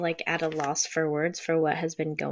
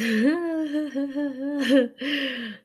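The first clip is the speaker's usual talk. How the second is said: a disbelieving burst of laughter; incredulous laugh; 7 semitones higher than usual. Real, not acted. Laughs at the nude photoshop.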